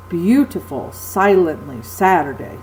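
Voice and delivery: Beautiful, silently, Saturday, each accented on its first syllable; strong-weak-weak beat on Beautiful, silently, Saturday; dactylic stress. Shown as a demonstration. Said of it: "accented syllable followed by two unaccented".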